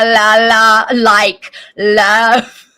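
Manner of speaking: loud, careful "La, la, like, love" with a crisp L; over-pronounce the L in "love"; correcting the L. Shown as a demonstration. Mother drilling her son on the L sound, loudly. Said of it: "trying to correct it".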